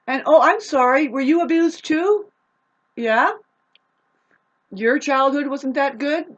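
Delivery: high, loud mock concern; sneering sarcastic fake pity, pitched high; sarcastic mock sympathy. Real, not acted.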